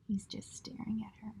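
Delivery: soft unvoiced whisper to the class; whispered aside. Real, not acted. Teacher showing the class a picture, whispering. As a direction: lean in and whisper it.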